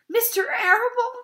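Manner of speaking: a mock sob of disbelief; sobbed. A narrator's reading. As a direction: wail the name as a question. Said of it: "sobbed Wilbur".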